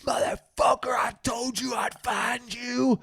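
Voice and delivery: high, yelling threat out the car window; mock road-rage yell; angry yell. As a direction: shout it like a crazed driver.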